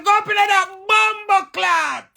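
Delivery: Papa's order, loud, high and slow; father's command. Retold or imitated. Father orders his son up the tree, loud slow shout. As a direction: bark it up the tree like an angry father. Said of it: "Papa say".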